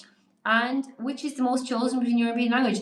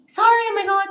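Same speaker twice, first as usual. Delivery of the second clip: sudden embarrassed oh my God; 9 semitones higher, 6 dB louder, faster than usual. Real, not acted. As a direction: voice jumps high and loud in surprise. Realising her own typo.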